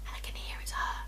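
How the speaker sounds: barely audible whisper. Retold or imitated. Girl outside her room listening to voices downstairs, whispering. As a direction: whisper it as if nobody must hear. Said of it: "why am I whispering?".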